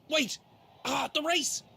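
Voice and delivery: high, urgent cries after a gasp; frantic excitement. Acted. Prince realising he missed the race finish, frantic excitement.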